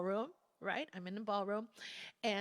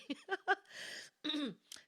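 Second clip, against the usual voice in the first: real laugh; 8 semitones higher than usual. Real, not acted. Laughing at retelling the story.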